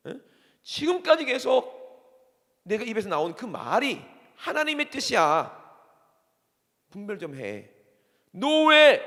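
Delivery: loud, high, impassioned scolding; Jesus rebuking. Acted. Preacher as Jesus scolding Peter's human thinking, thundering rebuke. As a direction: hammer out indignant questions with bursts of shouting. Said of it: "예수님께서 뭐라고 그런 거예요?".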